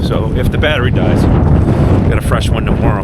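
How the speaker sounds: shouting over wind, freezing cold; cold and windy. Real, not acted. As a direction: talk loud against cold wind. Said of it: "I'm freezing my balls off".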